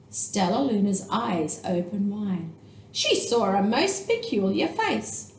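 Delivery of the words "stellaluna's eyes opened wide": plain reading voice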